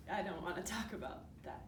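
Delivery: low, soft and reluctant; quiet refusal. Acted. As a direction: close the subject softly. Acted interviewee refuses painful topic.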